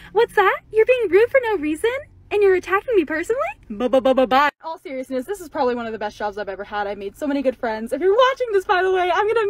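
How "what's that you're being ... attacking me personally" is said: mock customer service reply. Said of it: "my call center voice".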